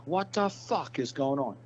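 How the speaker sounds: an angry cop's disbelief, drawn out; imitating a mad cop. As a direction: ham up the furious officer. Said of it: "That cop got so mad".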